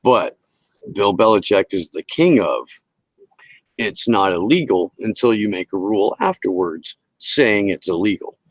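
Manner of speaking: ordinary talk, nothing marked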